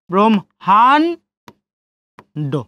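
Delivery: ব্রহ্মাণ্ড slowly as "brom-haan-do"; m before h in ব্রহ্মাণ্ড, drawn out; syllable-by-syllable demo. Shown as a demonstration. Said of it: "ম এবং হ".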